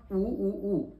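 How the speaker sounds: उ said short, then long, then extra-long; vowel lengths. Shown as a demonstration. Teacher demonstrating three vowel lengths to students, slowly. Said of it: "ह्या तीन ऊंना उच्चारण्यासाठी जेवढा वेळ".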